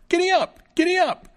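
very high, loud little-fellow yelps; shouted commands. A narrator's reading. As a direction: shout horse commands in a tiny voice. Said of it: "the little fellow cried out".

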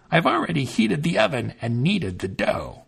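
a witch setting her trap. A narrator's reading. Witch speaks.

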